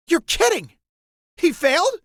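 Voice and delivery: high, loud shocked cry; shocked disbelief. Acted. Riho hears Lloyd failed, shocked high cry. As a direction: blurt it out in disbelief.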